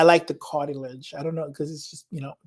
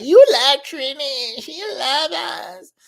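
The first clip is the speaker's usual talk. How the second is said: higher, slow, singsong Trini-flavoured quote; best friend's teasing voice; 9 semitones higher, 6 dB louder, slower than usual. Retold or imitated. Best friend teasing about loving Trini, slow singsong quote. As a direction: play the best friend ribbing you. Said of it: "She's like".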